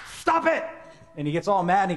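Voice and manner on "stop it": high, loud shout to stop him; angry shout. Acted. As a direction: yell him into silence. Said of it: "And Balak said".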